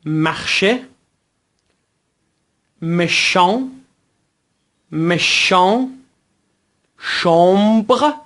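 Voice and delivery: French word list from مخشه to اند, each set apart; careful teacher's drill, مخشه through اند; repeat-after-me model. Shown as a demonstration. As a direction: model مشان and شامبر clearly with nasal vowels. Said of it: "به دنبال بنده تلفظ کنید".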